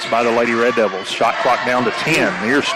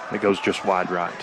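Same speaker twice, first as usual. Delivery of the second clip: golf commentator; 3 semitones lower, 5 dB quieter than usual. Acted. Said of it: "you have the voice for golf".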